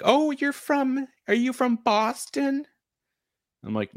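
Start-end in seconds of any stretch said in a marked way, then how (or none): 0.0-2.6 high, drawn-out mock curious question; wide-eyed curiosity. People out west asking a kid about Boston, high mock curiosity. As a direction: imitate intrigued strangers, pitched way up.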